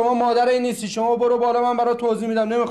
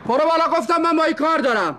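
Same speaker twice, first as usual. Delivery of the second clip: raised, sharp command to go upstairs; angry order; 4 semitones higher than usual. Acted. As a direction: order her away, fed up. Brother angrily orders sister upstairs.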